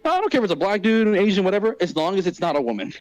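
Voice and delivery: a hint of a casual, opinionated mother; imitated mom. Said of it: "here's a quote of my mom".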